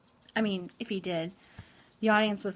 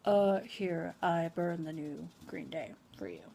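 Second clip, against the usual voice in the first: mumbled, offhand slacker voice, softer and lower by the end; awkward slacker boyfriend; 4 semitones lower, 3 dB quieter than usual. Acted. Slacker hands a girl a burned CD, offhand mumble. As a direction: toss it off like an uncommitted guy, anticlimactic. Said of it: "when he hands her a C D and says".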